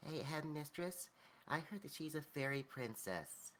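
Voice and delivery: put-on a tiny gnome child tugging a dress; little child voice. Child tugs headmistress's dress, piping little-kid voice. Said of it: "just tug on the headmistress' dress as it goes".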